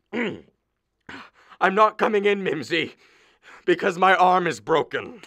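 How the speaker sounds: high, slow, strained declaration; hurt husband calling through the door; pained announcement. Acted. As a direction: drag it out like a wounded martyr. Injured man announces broken arm.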